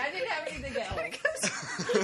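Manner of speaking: high, slow, breaking up with laughter; giggling through the excuse; laughing. Real, not acted. Explaining the granola prank to co-hosts, laughing high.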